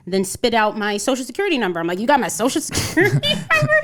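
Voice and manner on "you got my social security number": high, fast, incredulous retelling; laughing disbelief. Retold or imitated. Retelling her shock at the caller, laughing disbelief. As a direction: race upward in pitch, breaking into laughter.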